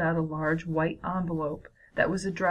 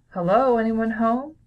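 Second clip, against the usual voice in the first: loud, higher call through the house; 4 semitones higher, 7 dB louder than usual. A narrator's reading. Grandpa interrupts the children's conversation, calling out loudly. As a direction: call out to see who's home.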